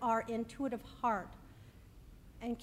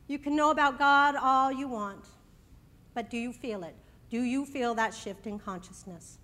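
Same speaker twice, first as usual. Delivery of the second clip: raised, ringing preacher voice; preaching; 2 semitones higher, 7 dB louder than usual. Acted. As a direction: inspirational sermon delivery. Opening sermon message.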